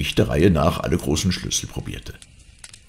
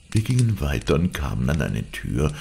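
ordinary delivery

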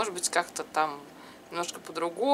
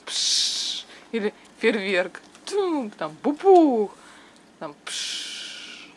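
firework sound effects; much slower than usual. Pretend. Mimicking fireworks popping and fizzing.

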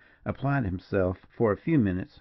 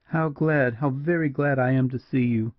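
high, heartfelt gladness; moved, pitched-up outpouring; faltering with emotion; 4 semitones higher than usual. A narrator's reading. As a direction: greet them as if near tears. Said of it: "her sweet low voice faltered as she spoke".

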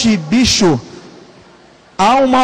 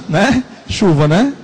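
ordinary delivery

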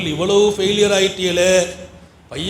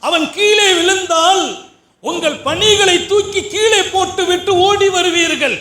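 fiery preaching; 12 semitones higher than usual. Acted. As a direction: thunder the point at the parents.

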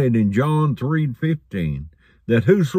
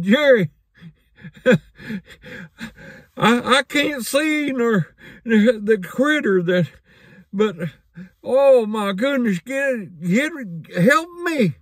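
mock panicked plea; 10 semitones higher than usual. John's panicked plea from tree.

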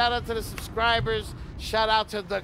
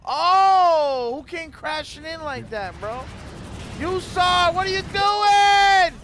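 high, loud startled shouts; loud shock; 8 semitones higher, 8 dB louder, slower than usual. Real, not acted. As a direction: shout at the chaos. Cars crash around him.

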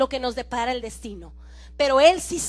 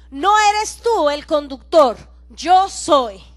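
louder, firm declaration; firm Jesus voice; 8 dB louder, slower than usual. Retold or imitated. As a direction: say it like Jesus taking the wheel. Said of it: "Jesús dijo". (Jesús, firme y fuerte)

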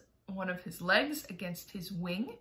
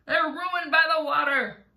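high, loud complaint; cried out; 6 semitones higher, 6 dB louder than usual. A narrator's reading. Centipede laments ruined boots, crying out. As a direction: wail it in dismay. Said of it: "cried the centipede".

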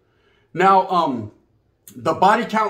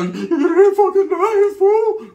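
much higher, louder, slow "It ain't fucking nice, fool"; how it really sounded; 11 semitones higher, 5 dB louder, much slower than usual. Retold or imitated. The brother's real meaning as heard, a slow loud angry outburst. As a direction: let the hidden rage burst out, stretching "fucking". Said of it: "that was really the way I heard it".

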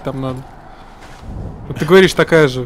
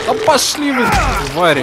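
high angry cry at the monsters; angry yell; 7 semitones higher than usual. Real, not acted. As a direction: yell it in frustration. Swarmed by monsters.

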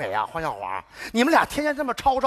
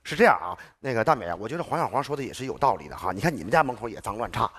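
smooth her down, voice dropped low; a fawning mediator calming the neighbour, pitched much lower; placating; 11 semitones lower than usual. Acted. Sudden shift to smooth, placating tone.

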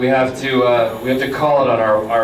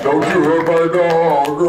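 slow, drawn-out creepy quote in a higher, lightly put-on voice; Buffalo Bill impression; 5 semitones higher, slower than usual. Panelist doing a movie villain line for the crowd, slow and creepy. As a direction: draw each word out like a menacing movie villain. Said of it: "That was a pretty good impression".

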